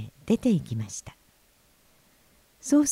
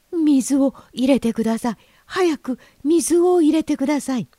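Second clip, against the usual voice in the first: high, loud pleading fish; raised, high-pitched plea for help; urgent chorus; 8 semitones higher, 5 dB louder than usual. Acted. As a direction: beg urgently, as if all crying out together. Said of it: "金魚は声を揃えて言いました".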